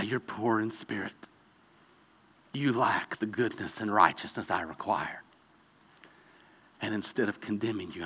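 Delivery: hushed, barely voiced and gentle; tender whisper of forgiveness. Jesus quietly forgiving the condemned sinner, a tender hush. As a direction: breathe the pardon softly, like mercy itself.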